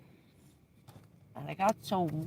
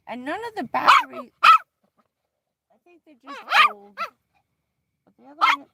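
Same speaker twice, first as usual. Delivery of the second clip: loud over noise; 13 semitones higher, 10 dB louder than usual. Real, not acted. Talking over the screaming bird.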